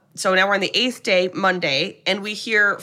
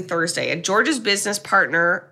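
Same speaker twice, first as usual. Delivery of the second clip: normal talking voice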